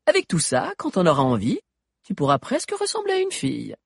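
put-on high, bright girly voice; high teen girl voice. A narrator's reading. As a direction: play a giggly teenage girl. Sabi's girlish character voice.

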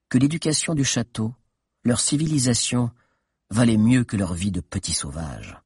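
ordinary talk

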